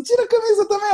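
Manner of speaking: very high, giddy wife voice; excited wife voiced. Wife tells husband to take off his shirt too, very high giddy voice. Wife urges him to strip.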